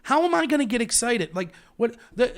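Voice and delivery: ordinary delivery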